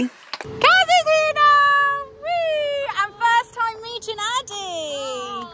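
high, stretched-out singsong greeting; very high, drawn-out cheer; excited squeal. Real, not acted. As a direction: squeal with delight at a friend arriving. Rider hails friend's arrival.

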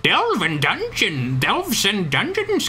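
very high, slow put-on voice; high, drawn-out fantasy narration; dungeon lore narrator. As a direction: stretch the words out slowly in a high-pitched character voice.

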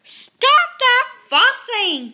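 slow, high, loud shouted order; booming, drawn-out scolding; loudest Papa Bear voice. A narrator's reading. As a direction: roar it like an exasperated father. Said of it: "in his loudest Papa Bear".

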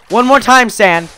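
loud, high threat; shouted warning. Real, not acted. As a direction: yell a last warning. Warning repeated, shouted at sand.

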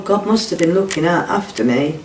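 ordinary talk, nothing marked

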